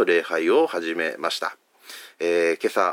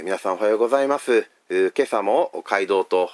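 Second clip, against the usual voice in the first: ordinary talk, nothing marked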